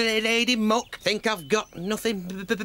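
normal talking voice

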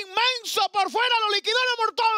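high, shouted race finish; excited shout. Real, not acted. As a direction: yell the winner home.

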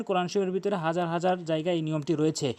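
normal talking voice

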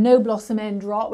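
normal talking voice

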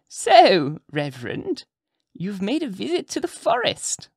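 put-on high, sly old witch voice; witch lady. A narrator's reading. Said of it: "observed the witch lady".

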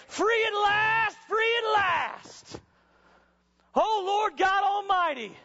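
slow, high, loud shout of joy; joyful shout. Acted. Healed leper walking away from Jesus, slow joyful shout. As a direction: shout it out like a man just set free.

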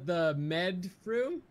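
drawn out. Real, not acted. Drawn-out reveal.

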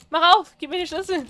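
loud, high shout at the game; shouted demand. Real, not acted. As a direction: yell it at the locked door. Ruft Spielfigur an. (laut rufend, fordernd)

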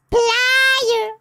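very high, stretched-out squeal; silly high squeal. Pretend. Silly calling for game item.

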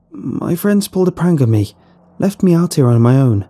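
a hint of a scared young narrator making an excuse; lightly voiced young stranger lying to the farmer; young lost traveller. A narrator's reading.

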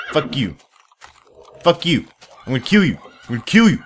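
building playful anger; mock rage. Real, not acted. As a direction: yell at the stubborn horse. Raging at horse bucking.

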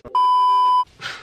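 sudden very high squeal of a laugh; a frustrated shriek-laugh; real squeal. Real, not acted. Frustrated with her hair.

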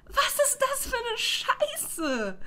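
high, slow, half-crying complaint; whiny outcry. Real, not acted. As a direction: wail it in despair. Exasperated outcry at family.

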